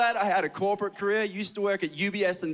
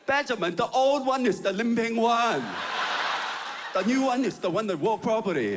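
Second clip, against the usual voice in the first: put-on higher, exasperated mom in a Chinese accent; 6 semitones higher than usual. Chinese mom explaining two dogs to her son, high Chinese accent. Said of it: "do quite a lot is the Chinese accent".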